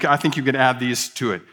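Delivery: normal talking voice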